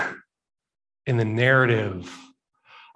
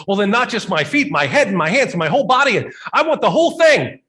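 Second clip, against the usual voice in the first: much higher, louder, eager rush; exuberant Peter; 8 semitones higher, 6 dB louder, faster than usual. Retold or imitated. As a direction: burst out with eager enthusiasm. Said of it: "back to his usual exuberant self".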